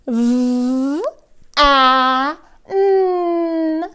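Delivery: V, A, N stretched phonics sounds; sounding out. Shown as a demonstration. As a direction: blend V A N sound by sound. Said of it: "start at the beginning again".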